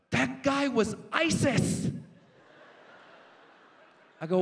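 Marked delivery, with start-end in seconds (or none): 0.1-1.9 put-on high, drawn-out panicked kid; gasp, then blurt it in slow, high alarm; freaking out. Little boy from Wisconsin alerting the comic, freaking out. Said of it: "he starts freaking out".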